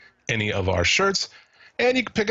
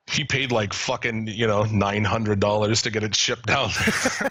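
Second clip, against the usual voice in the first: ordinary delivery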